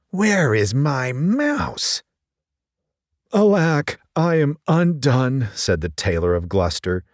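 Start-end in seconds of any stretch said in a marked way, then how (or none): 0.1-2.0 cat voice. Acted. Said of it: "if Simpkin had been able to talk". 3.3-5.5 higher, slow despairing tailor; a mild touch of hammed-up woe; sad tailor. Tailor giving up on finding his twist, mild sorrowful sigh. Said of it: "went sadly to bed".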